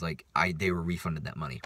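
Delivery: normal talking voice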